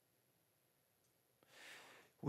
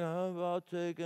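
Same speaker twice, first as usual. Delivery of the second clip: disengaged diner; 4 dB quieter than usual. Retold or imitated. College friends ordering without looking at the server, disengaged. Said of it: "they're just looking like".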